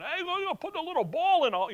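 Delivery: high, rushed comic Scottish golfer; squeaky, fast mock-Scots rambling; Robin Williams. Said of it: "saw Robin Williams".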